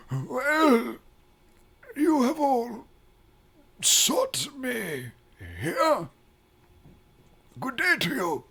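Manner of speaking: put-on high, frail old man voice; quavering elderly temple master.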